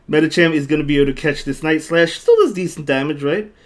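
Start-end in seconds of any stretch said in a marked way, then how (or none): none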